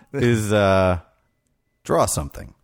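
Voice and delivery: ordinary talk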